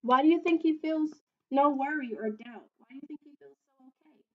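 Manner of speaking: ordinary delivery, nothing marked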